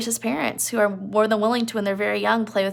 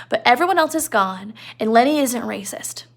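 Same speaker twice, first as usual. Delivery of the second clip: normal talking voice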